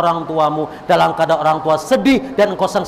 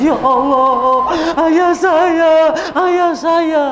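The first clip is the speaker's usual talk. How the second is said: much higher wailing cry, slow; retold wailing child; 12 semitones higher, 3 dB louder, much slower than usual. Child hearing of father's death, wailing. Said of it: "Anak kejer nangis".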